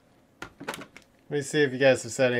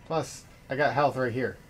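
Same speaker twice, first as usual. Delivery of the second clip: usual speaking voice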